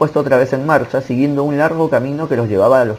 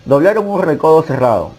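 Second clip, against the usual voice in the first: plain reading voice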